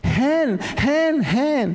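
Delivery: high mimicked child's cries; imitated child's call. Father showing how his child called for his hand, high cries. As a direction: cry out high, as a frightened child would. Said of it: "dia panggil tangan saya".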